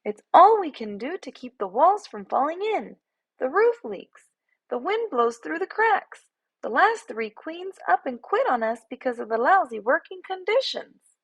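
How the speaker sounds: a small bee talking down to a bear; sassy tiny bee. A narrator's reading. Bee lists hive troubles.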